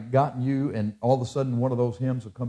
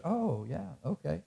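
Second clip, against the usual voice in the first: soft, slightly raised mock reply; vague recognition; 3 semitones higher, 12 dB quieter than usual. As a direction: quietly play along, as if half remembering. Acting out forgetful residents' reply.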